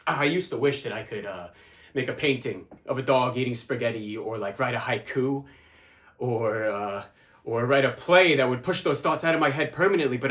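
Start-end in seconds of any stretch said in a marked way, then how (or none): none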